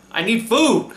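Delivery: laughing through the words. Real, not acted. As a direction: say it while cracking up. Joking about pies.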